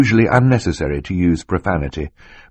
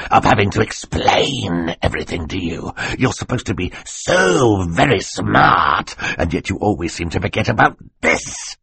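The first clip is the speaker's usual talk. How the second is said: snarl. Acted. Stefano exasperated at explaining to the boy, snarling. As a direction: lean hard into the stretched words. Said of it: "Stefano snarled".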